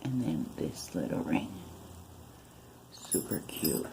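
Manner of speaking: soft low whisper. Real, not acted. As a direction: hush it, almost breath only. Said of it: "I'm whispering".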